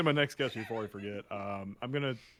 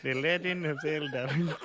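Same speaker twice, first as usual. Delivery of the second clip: Zelda with a rolled Spanish lilt; Spanish accent; 4 semitones higher, 4 dB louder, slower than usual. Retold or imitated. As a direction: say it like a native Spanish speaker. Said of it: "That sounded like authentic Spanish".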